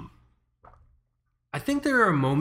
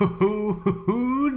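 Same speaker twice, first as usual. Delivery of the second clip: drawn-out high hoo-hoo; excited whoop; 9 semitones higher, slower than usual. Real, not acted. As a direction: whoop with delight. Excited at seeing the watch.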